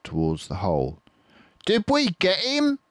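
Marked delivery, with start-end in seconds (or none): 1.7-2.8 high, loud, put-on farmer voice; eager squeaky Bean. Bean asking the others after shooting, high and eager. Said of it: "said Bean".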